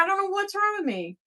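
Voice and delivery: usual speaking voice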